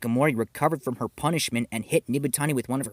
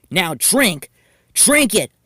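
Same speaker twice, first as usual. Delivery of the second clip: louder, rising insistent orders; bossy, escalating demand; forceful command; 7 semitones higher, 8 dB louder, slower than usual. Acted. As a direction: push the glass at her, demanding. Forcing milk on pinned girl.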